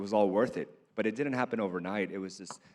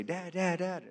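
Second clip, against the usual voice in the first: higher, lightly voiced child calling dad; imitating eager daughter; 10 semitones higher, slower than usual. Daughter he wished would greet him at the door, light high imitation. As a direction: call out like a little girl thrilled to see dad.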